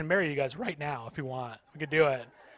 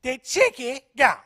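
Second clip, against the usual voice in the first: higher, raspy-toned cartoon kid voice of a lifelong smoker; smoker Cartman voice; 7 semitones higher, 7 dB louder, slower than usual. Comic imitating the chicken lady yelling to the crowd, Cartman voice. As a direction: yell it like Cartman after decades of cigarettes. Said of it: "she sounded like Eric Cartman from South Park had been smoking his entire life".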